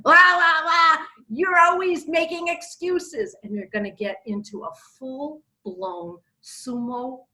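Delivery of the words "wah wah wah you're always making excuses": high, loud wah-wah nagging; hammed-up quarrel voice, pitched high; mock whining retort. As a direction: mimic a spouse's complaint as noisy wailing. Mocking the wife's angry reply.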